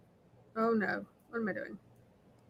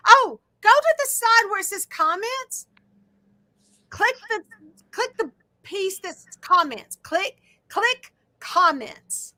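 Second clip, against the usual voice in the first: raised, higher-pitched instruction voice; yelling; 6 semitones higher than usual. Real, not acted. Telling Brenda where to click on a bad phone line, yelling. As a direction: call out directions so they carry. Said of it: "I'm sorry, I'm yelling".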